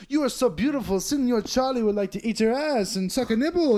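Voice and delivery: hammed-up Senor Charlie sweet-talking; comedian's stage character. Mimicking open micer's crude line to a lady, hammed-up stage character. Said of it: "And then he goes".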